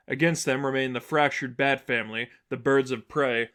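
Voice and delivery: ordinary delivery, nothing marked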